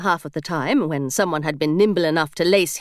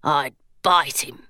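low, slow menacing gargoyle; a gargoyle growling a threat; dark gargoyle threat; 6 semitones lower, slower than usual. A narrator's reading. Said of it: "the gargoyle said darkly".

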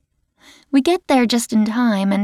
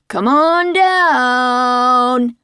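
long, drawn-out slow host call; game show host; 4 semitones higher, 4 dB louder, much slower than usual. A narrator's reading. Unit head inviting Alice up, game show host style. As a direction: stretch it like a TV host inviting a contestant. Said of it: "like he's a game show host".

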